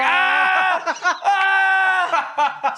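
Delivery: high, loud yelps through laughter; cackle-screaming in the lobby; laughing scream. Retold or imitated. Teasing his brother in the lobby with laughing screams.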